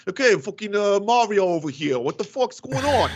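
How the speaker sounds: brash mock Brooklyn accent; bad Brooklyn accent. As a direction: do a half-baked Brooklyn accent, loudly. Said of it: "trying to do a Brooklyn accent".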